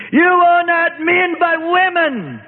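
high, slow, shrill-voiced old mother's cry; voiced aged mother crying out for rescue; old woman screaming. A narrator's reading. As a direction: wail it as a desperate old woman. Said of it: "her aged mother screamed".

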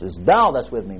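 "thou" leaned on, the rest falling low; stress on thou. Shown as a demonstration. Preacher explaining the verse word by word, stress on "thou". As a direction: punch "thou" to single out who is with me. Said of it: "Emphasize every word".

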